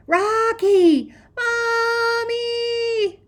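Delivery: piercing, drawn-out screams; panicked child's shriek, very high and loud; screaming. A narrator's reading. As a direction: scream for help at the top of your voice. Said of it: "Teresa screams".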